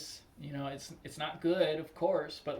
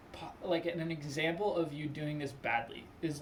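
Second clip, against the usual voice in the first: ordinary delivery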